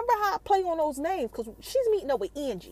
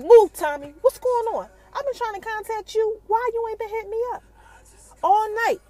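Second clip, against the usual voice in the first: put-on 1 demanding girlfriend; 8 semitones higher, 5 dB louder than usual. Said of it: "Keisha like".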